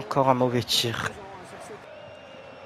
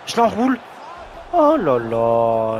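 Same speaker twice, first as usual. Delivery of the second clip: excited; 5 dB louder, slower than usual. Real, not acted. Curled shot misses.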